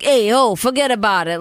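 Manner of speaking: put-on loud opening shout in New York accent; mock New York mobster. Mocking a Kansas City mobster with a New York accent. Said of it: "from Kansas City talking like".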